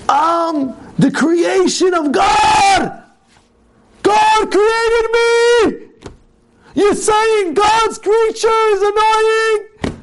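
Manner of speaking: slow, high-pitched imitated scream. Stranger who was called annoying, screaming slowly at the shopper. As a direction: draw out each word in an outraged mock scream. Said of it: "Screaming on top of his lungs".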